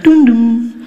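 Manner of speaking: sung two-note airport chime; chime imitation. Comic imitating the airport chime before an announcement. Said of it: "she'll come and be like".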